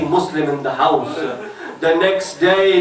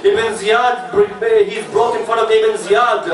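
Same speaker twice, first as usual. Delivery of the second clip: higher, climbing majlis recitation; rising lament preaching; 3 semitones higher than usual. Acted. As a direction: build the grief, pitch climbing.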